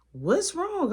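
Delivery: worried sudden question; alarmed question. Retold or imitated. Friend alarmed.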